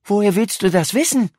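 mildly astonished question. A narrator's reading. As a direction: ask with a touch of surprise. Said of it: "fragte Max erstaunt". (leicht erstaunt gefragt)

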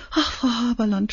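tearful sniffle; sniffling. A narrator's reading. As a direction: sniffle through tears. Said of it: "schnuffelte sie".

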